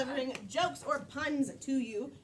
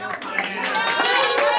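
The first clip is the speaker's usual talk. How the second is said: very slow, high, loud drawl; drawn-out voice; 11 semitones higher, 15 dB louder, much slower than usual. Acted. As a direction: stretch it way out. Character voice reply.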